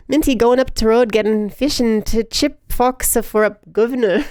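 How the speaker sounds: put-on British accent. Streamer reading a chat message, imitation British accent. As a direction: read it in a mock British accent. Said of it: "Is that supposed to be a British accent?".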